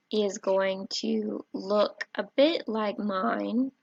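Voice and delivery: ordinary talk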